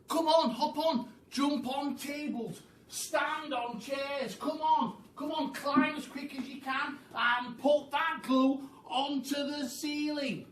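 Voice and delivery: high, loud, eager rallying calls; frenzied urging. Acted. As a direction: whip the animals into action.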